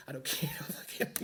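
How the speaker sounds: whispered and breaking into a laugh. Pretend. Imagined soldier to Gunny, whispering through a laugh.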